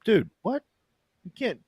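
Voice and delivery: high, startled outcry at a spoiler; shocked friend demanding where he heard it; shocked disbelief. Real, not acted. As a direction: react like he just ruined the show.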